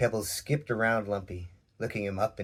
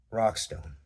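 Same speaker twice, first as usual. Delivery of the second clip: ordinary delivery, nothing marked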